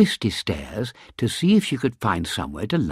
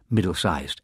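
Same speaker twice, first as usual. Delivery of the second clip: ordinary delivery, nothing marked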